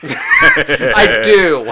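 laughing shout of I do. Real, not acted. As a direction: blurt it out through laughter. Laughing admission of podcast.

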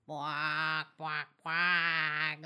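low quacking noises; chicken quack. Acted. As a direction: quack it out like a duck. Chicken quacks before answering.

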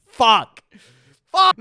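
loud frustrated swearing; frustrated shout. Real, not acted. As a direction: yell it out in frustration.

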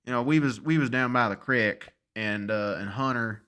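southern-ish Utah accent. Shown as a demonstration. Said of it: "distinctly southern-ish sound".

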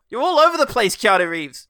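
loud, high mock telling-off; mock exasperation. Host scolds Keanu for rambling, mock exasperation. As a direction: raise the voice in comic disbelief.

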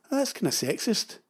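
lightly smug, quiet retort; a mildly smug jab at a sexist remark. Retold or imitated. Husband needling wife over painting, quietly smug. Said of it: "I interjected in a kinda smug way".

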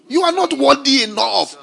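shouted sermon defiance; defiant preaching. Acted. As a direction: throw it at them in defiance.

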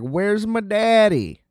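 lightly voiced young child, a bit slow; little girl voice. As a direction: play the little girl wanting her daddy. Little girl asking for father.

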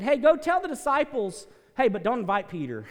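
high, loud sarcastic call; loud mock announcement. Pastor mocking how men would exclude Peter, loud and sarcastic. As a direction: shout it like a snide herald.

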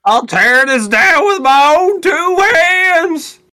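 high, slow, loud hammy threat; farmer voice. Acted. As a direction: ham up a raging old farmer.

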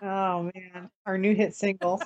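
ordinary talk, nothing marked

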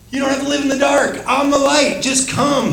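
high, quick, eager invitation; voicing Jesus inviting. Preacher voicing Jesus inviting the crowd, high and eager. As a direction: call them out of the dark like Jesus.

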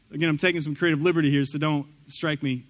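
ordinary talk, nothing marked

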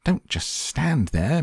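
high, drawn-out scolding; mock scream. A narrator's reading. Wife screaming at husband to act, high mock scream. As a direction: scold high and slowly, without full volume. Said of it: "Missus Gloop screamed at Mister Gloop".

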